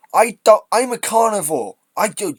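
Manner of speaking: loud, high-pitched protest; indignant outburst. Real, not acted. Commentator reacts to tofu eating woke jibe, indignant outburst. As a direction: burst out, affronted.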